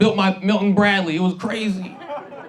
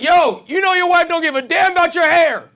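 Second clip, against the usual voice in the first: higher and louder friend calling out; friend yelling; 10 semitones higher, 4 dB louder than usual. Retold or imitated. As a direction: call it out across the room, teasing. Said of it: "my friend yelled out to me".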